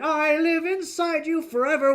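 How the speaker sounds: high, slow creepy puppet voice; creepy sock puppet voice. Retold or imitated. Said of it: "the guy being like".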